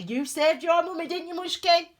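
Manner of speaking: high, loud sing-song pet voice. Real, not acted. Owner thanking her tiny dog, cooing high baby talk. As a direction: coo praise at the little dog.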